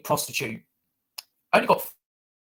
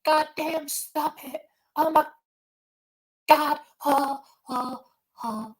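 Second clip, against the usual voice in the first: high-pitched hammed-up squeal, higher; lightly voiced southern girl squealing; mock South Carolina girl; 10 semitones higher, much slower than usual. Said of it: "In South Carolina, it's like".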